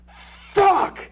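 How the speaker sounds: sudden high, loud angry shout of fuck!; furious yell. Retold or imitated. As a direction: explode like an actor blowing his take. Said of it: "swearing and yelling".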